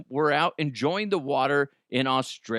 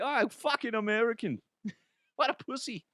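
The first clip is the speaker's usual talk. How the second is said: a bad, higher Australian accent, mocking; 10 semitones higher, 4 dB quieter than usual. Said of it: "That's not even a good accent".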